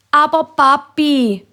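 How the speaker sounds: hohes, gedehntes Kinderbetteln; bettelnd. A narrator's reading. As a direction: bettle langgezogen beim Papi. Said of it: "bettelt Nina und der Roger". (bättlet höch und langzoge)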